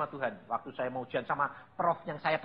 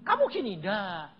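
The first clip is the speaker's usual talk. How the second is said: mock scolding; 3 semitones higher than usual. Shown as a demonstration. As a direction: show how God doesn't talk. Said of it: "Ngomongnya ndak". (pura-pura menegur dengan nada tinggi)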